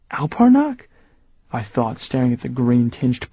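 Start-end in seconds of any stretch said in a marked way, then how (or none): 0.1-0.9 high, disbelieving echo; high startled question; stunned. Acted. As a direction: repeat it in shock.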